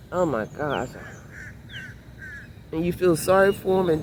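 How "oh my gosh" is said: ordinary talk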